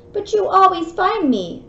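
complaining. Acted. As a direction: mildly protest like a little kangaroo. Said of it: "Roo complained".

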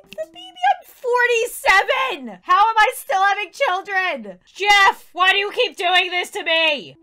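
loud, exasperated wail at the pregnancy; exasperated outcry. Real, not acted. Mom yelling at husband Jeff over another baby, exasperated wail. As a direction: cry out in mock despair.

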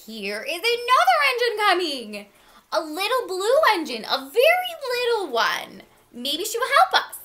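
high, loud excited clown; little clown's high-pitched announcement; calling out. A narrator's reading. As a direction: call it out with rising hope. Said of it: "the little clown called out".

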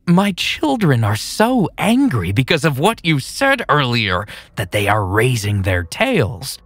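high, eerie psycho voice; creepy villain voice. Acted. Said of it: "he said".

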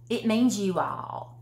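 ordinary delivery

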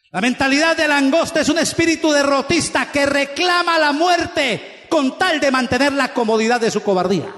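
ordinary talk

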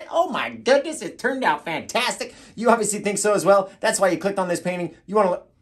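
high, loud intro patter; hyped presenter. Acted. Host introducing the finished painting to viewers, hyped up. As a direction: sell the painting with showy amazement. Performed intro take after cue.

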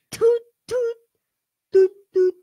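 high, punchy toot noises; imitated toots. Player imitating the game's noises, playful toots.

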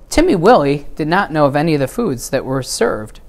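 ordinary delivery

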